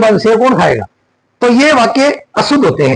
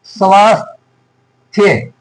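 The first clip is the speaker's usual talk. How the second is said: स्वास्थ्य slowly split as "svaas... thya"; slow correct form; much slower than usual. Shown as a demonstration. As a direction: draw out the long aa in स्वास्थ्य, pause before "thya". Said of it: "ये होता है".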